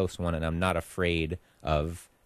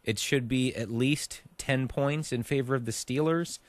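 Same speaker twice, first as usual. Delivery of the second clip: ordinary talk, nothing marked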